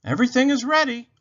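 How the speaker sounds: high, loud, quick rabbit voice; excited rabbit. A narrator's reading. Rabbit is ready for the favorite show, high and loud. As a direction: announce it high and fast. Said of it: "said Rabbit".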